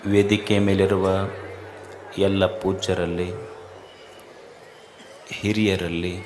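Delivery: low, soft, devout salutation to the gathering; hushed formal greeting before a sermon; solemn invocation. Acted. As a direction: open the sermon quietly and reverently.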